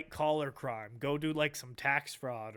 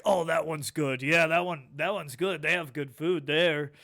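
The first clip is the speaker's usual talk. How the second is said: louder, eager jail reviewer voice; loud inmate impression; 8 dB louder than usual. Inmates rating jails to each other, loud and eager. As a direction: hype the jail like a good restaurant.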